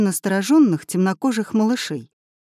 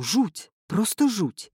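usual speaking voice

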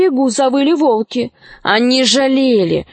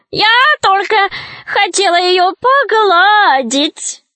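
high, drawn-out witch voice; flustered witch; 9 semitones higher, slower than usual. A narrator's reading. Witch makes excuses about Alisa, flustered high drawl. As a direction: protest innocence with stretched, high words. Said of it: "засуетилась ведьма".

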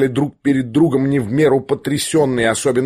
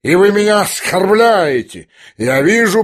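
slow, high indignant protest; offended outburst; 5 semitones higher, slower than usual. A narrator's reading. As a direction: protest like an insulted old general, stretching the words. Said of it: "крикнул генерал". (оскорблённо, с возмущением и нажимом)

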